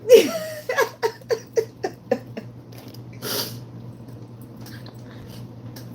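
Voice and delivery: long high airy laugh through tears; teary laugh. Real, not acted. After the gospel quote, teary airy laugh.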